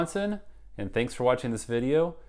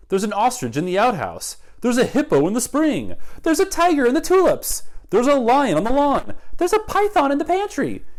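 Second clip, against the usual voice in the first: rising panicked cries, much higher and louder; alarmed villagers; 10 dB louder than usual. Acted. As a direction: build from alarm to shrill panic.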